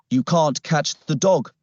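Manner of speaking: usual speaking voice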